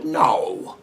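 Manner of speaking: a mock-soothing low denial. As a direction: brush the question off low and quiet. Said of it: "he would go".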